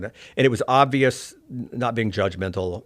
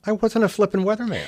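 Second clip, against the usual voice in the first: mock indignant; 11 semitones higher than usual. Indignant retold reply.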